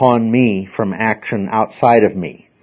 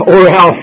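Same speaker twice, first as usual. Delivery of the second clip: "or else" punched louder and higher; threatening emphasis; 6 semitones higher, 8 dB louder than usual. Shown as a demonstration. Teacher demonstrating a threat to the class, loud emphatic warning. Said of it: "adding a consequence to it".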